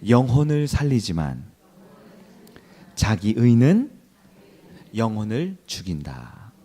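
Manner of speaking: 하나님의 의는 said slowly with long pauses between phrases; slow repeat-after-me. Shown as a demonstration. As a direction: spell it out phrase by phrase for the congregation to echo. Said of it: "한번 따라해 볼까요?".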